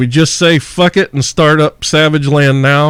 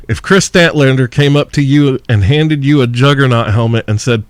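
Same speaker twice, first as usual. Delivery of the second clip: normal talking voice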